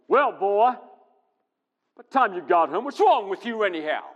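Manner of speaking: angry father demanding answers; stern scolding father. Retold or imitated. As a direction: scold the boy like a frowning dad. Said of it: "frowning, saying".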